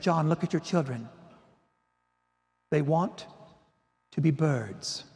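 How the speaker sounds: low, soft, halting mystical imitation; trance-like pronouncement. Mentor in a trance reveals what the children want, hushed. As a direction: deliver it as a hushed vision, with long pauses. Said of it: "went into this trance".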